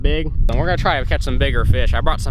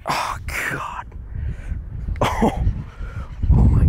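overwhelmed; slower than usual. Real, not acted. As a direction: whisper it, overwhelmed by the catch. Overwhelmed by another big fish.

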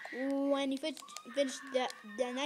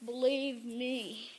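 normal talking voice

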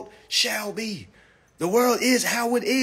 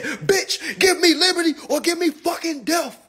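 higher, hammed-up street version of the famous line; modern Patrick Henry; 7 semitones higher than usual. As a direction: shout it like a defiant rapper. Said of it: "he didn't say it like that".

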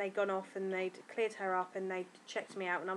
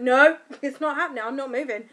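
loud, laughing "not happening"; laughing refusal; 5 semitones higher, 12 dB louder than usual. Retold or imitated. Retold refusing to move in labour.

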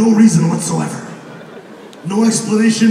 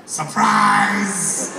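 drawn-out singsong surprise; mock reveal. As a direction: sing it out like a party reveal. Mock reveal of former career.

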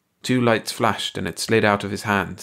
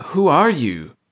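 choked; 7 semitones higher, 5 dB louder, slower than usual. A narrator's reading. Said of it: "Clark choked again".

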